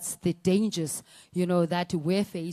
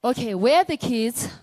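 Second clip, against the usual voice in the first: giving up; 6 semitones higher, 9 dB louder than usual. Retold or imitated. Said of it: "And he's like".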